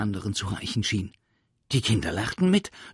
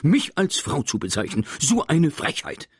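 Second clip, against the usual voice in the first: indignant teacher; 8 semitones higher, 7 dB louder than usual. Acted. Offended teacher scolds.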